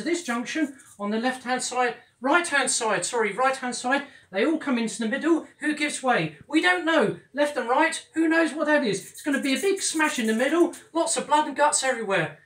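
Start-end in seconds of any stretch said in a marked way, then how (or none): 4.3-12.4 building excitement. Acted. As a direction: get carried away describing the smash. Instructor gets carried away.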